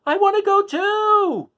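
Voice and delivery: loud, very high and slow desperate wail; Dorothy left behind, screaming to join the wizard. A narrator's reading. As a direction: wail it up at the sky. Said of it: "she screamed".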